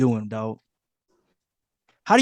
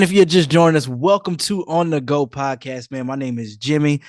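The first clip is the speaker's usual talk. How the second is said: normal talking voice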